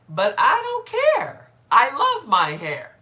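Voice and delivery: loud, slow, high-swooping comeback; retold defiant retort. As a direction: belt out a carefree comeback, stretching the words. Acts out defiant retort to critic.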